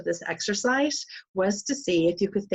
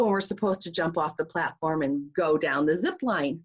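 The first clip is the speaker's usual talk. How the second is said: normal talking voice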